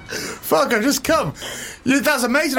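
high laugh bursting through the words. Real, not acted. Host cracks up at the knickers line, laughing. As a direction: laugh helplessly while talking.

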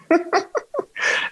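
high giggle; laughing. Real, not acted. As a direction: break into a giggle. Laughs at dildo kit.

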